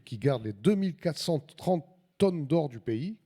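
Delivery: ordinary talk, nothing marked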